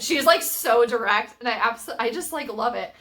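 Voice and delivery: excited. Real, not acted. Gushing about the heroine's directness.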